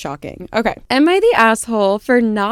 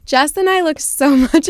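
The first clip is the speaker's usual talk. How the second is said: laughing; 7 semitones higher, 4 dB louder than usual. Real, not acted. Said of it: "I'm so sorry".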